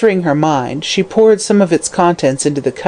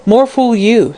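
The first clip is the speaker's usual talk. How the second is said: brief; 6 semitones higher, slower than usual. Acted. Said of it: "he said briefly".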